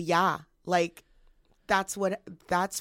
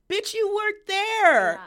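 high, slow, drawn-out sassy scold; sassy Tessa Thompson; 9 dB louder, much slower than usual. Retold or imitated. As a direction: stretch it out, high and scornful. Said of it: "she's like".

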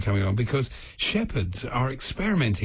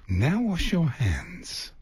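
very slow, higher announcer voice; talking toilet announcement; 4 semitones higher, much slower than usual. Pretend. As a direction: stretch each word out, pitched up.

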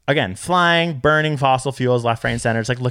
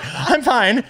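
high-voiced reassurance; 10 semitones higher than usual. Quoting own reassurance to panicked teacher.

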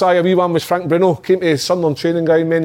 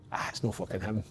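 much softer, lower retold thought; muttered aside; 6 semitones lower, 16 dB quieter than usual. Doubting the celebrity sighting to himself, muttered aside. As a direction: say it under your breath.

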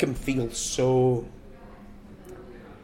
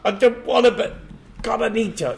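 much higher, louder mock protest; 12 semitones higher, 5 dB louder than usual. Mocking himself giving in to her, high squeaky voice. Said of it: "And it'd be like".

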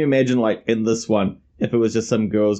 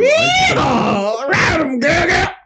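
cheering shout; 4 dB louder, much slower than usual. Pretend. Cheering the character on.

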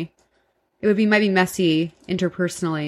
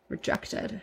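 deadpan whisper; 13 dB quieter than usual. Retold or imitated. Punchline of rejection story.

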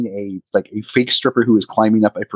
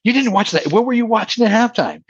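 incredulous, much higher, can't believe it; surprised disbelief; 11 semitones higher than usual. Real, not acted. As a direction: pitch jumps way up in disbelief. Surprised he skipped the show.

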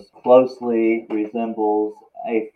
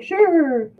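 high squeaky put-on tiger voice; comically high-pitched animal quote; agreeable tiger impression. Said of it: "Just like that. Sound exactly like that".

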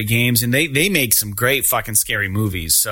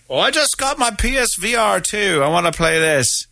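lightly voiced, higher aging rocker; a hint of a doddering rock star; 8 semitones higher than usual. Host retelling the PS VR2 promo, higher mock rocker voice. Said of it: "he's like".

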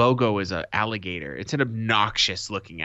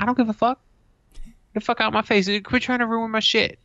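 higher, louder angry outburst; exasperated yelling; 12 semitones higher, 4 dB louder, faster than usual. Retold or imitated. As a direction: snap back at the clerk. Retorts to the shop clerk.

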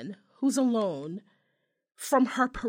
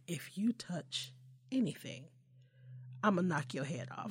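mother's warning; 4 semitones lower, 8 dB quieter than usual. Acted. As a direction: warn a child in a low, measured voice.

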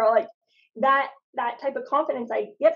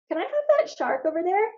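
put-on kid request; 8 semitones higher than usual. Child asking clerk for shark.

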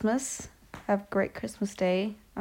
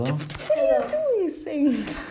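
surprised high call; 8 semitones higher, 4 dB louder, much slower than usual. Real, not acted. Surprised greeting to little brother.

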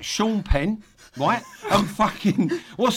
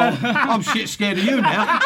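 laughing through mock fear, slow; gasping with laughter; 8 semitones higher, 5 dB louder, slower than usual. Real, not acted. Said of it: "I've got sweaty palms here".

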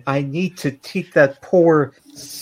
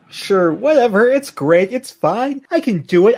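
higher and louder, cracking; strained bravado; 7 semitones higher, 4 dB louder than usual. Acted. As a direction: fake being fine through grief. Grieving Lemon insists he is fine.

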